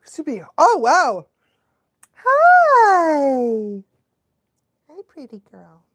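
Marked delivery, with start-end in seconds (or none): 2.1-3.8 high, loud hi to the dog; high dog greeting. Real, not acted. Owner greeting the dog who came over, high bright hi. 4.9-5.8 soft dog coo. Real, not acted. Cooing to the dog.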